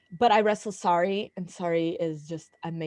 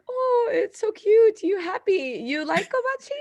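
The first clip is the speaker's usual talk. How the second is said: cooing teaser; 13 semitones higher, 4 dB louder than usual. Retold or imitated. Meiko teasing the starstruck wrestler, high cutesy coo. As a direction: coo at her like she's a kid. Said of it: "And Meiko was like".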